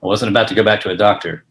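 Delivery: brooding detective narration; noir narration. Acted. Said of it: "as a film noir".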